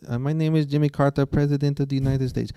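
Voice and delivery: put-on a hopeless Jimmy Carter through a foreign accent; foreign guy accent. Imitating Kaufman's awful Carter impression, foreign accent. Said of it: "most horrible impression of Jimmy Carter".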